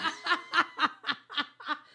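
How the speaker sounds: helpless big laugh; burst of laughter. Real, not acted. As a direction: crack up loudly at the joke. Bursts out at the joke.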